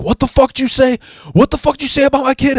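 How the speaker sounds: very high, fast, furious mock yell; caricatured rage voice; enraged husband. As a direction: shriek it like he's about to swing. Ex-husband enraged about kid insult.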